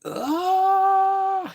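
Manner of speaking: short startled high cry; high mock yelp. Halloran hit by the tea, sudden high yelp. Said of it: "he was like".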